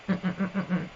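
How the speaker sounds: soft hummed syllable count; humming the syllables. Shown as a demonstration. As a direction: hum the beats under your breath.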